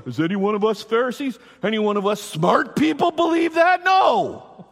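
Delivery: mock-incredulous question climbing high and loud; puffed-up leaders scoffing, pitch soaring; haughty religious bigwigs. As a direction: build to a shouted "No". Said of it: "big-time religious people go".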